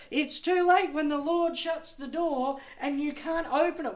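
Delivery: high, insistent pulpit appeal; impassioned preaching. Real, not acted. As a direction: plead and warn from the pulpit. Preaching at full force.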